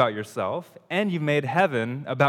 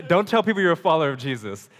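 louder, fast scolding line; hammed-up rebuke, louder and quick; mock exasperated retort; 4 semitones higher, 7 dB louder, faster than usual. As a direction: blurt it out sharply and fast. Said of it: "Or you should say".

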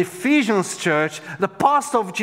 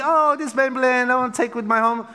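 mock cooing; 6 semitones higher, faster than usual. Mock cooing over cute lamb.

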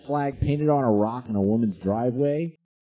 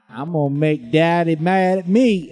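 mock rebellious daughter; 5 semitones higher, 6 dB louder than usual. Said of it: "she was like".

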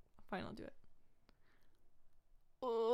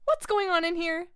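stern mom; 3 semitones lower, 8 dB louder than usual. Acted. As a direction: play the angry mother. S1 plays the mother.

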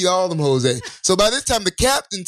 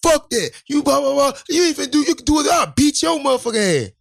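put-on drunk threat; 7 semitones higher than usual. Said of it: "The drunk dude like".

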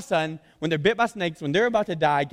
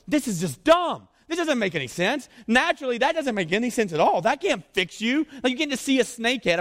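high, protesting mock disbelief; incredulous objectors; 8 semitones higher, 4 dB louder than usual. Preacher voices Israelites scoffing at the snake head, high and incredulous. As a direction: object in a raised, exasperated pitch. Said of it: "They're going".

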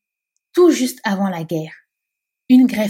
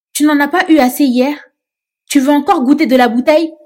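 loud, high shouted threat; furious yell; 7 semitones higher, 7 dB louder than usual. Acted. Mame Sophie yelling at the interpreter, loud furious shout. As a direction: scream it at him, higher and louder. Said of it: "hurla Mame Sophie".

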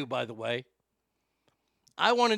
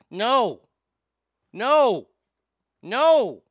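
horrified no; 4 semitones higher, 8 dB louder, much slower than usual. Real, not acted. Horrified reaction to the video.

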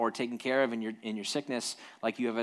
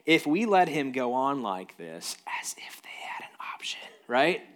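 scheming whisper; 2 semitones higher than usual. Acted.

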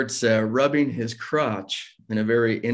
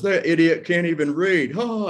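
higher, jeering sneer that shoots up on the oh; mocking taunt; 6 semitones higher than usual. Coworker jeering at him as he leaves, higher mock sneer. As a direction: jeer at him like a schoolyard bully.

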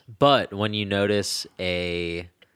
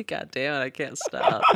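giggling exasperation; laughing; 8 semitones higher than usual. Real, not acted. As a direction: laugh it out helplessly. Laughing at repeated slip.

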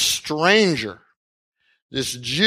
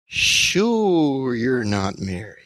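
eye-rolling sarcasm; 3 semitones lower, much slower than usual. Acted. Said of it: "Now Jesus rolls his eyes".

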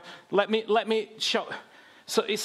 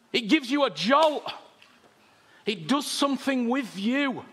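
full-force preaching; 4 semitones higher than usual. Acted. Preacher rousing the congregation, raised and nearly shouting.

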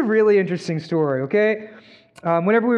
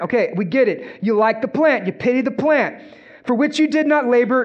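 raised, higher preaching drive; fiery preaching; 4 semitones higher than usual. Acted. Pastor driving home the lesson of Jonah's plant, fiery preaching. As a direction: preach it hard to the congregation.

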